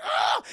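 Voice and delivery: short high-pitched acted scream; loud sudden yelp of alarm; mock startled scream. [name] is jumped on by the girl, mock scream.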